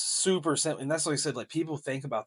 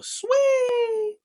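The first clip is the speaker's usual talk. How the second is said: very high, loud, long squealed "sweet"; drawn-out catchphrase squeal; 11 dB louder than usual. Shown as a demonstration. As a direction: stretch the word into a piercing high squeal. Sung-out catchphrase of brand name.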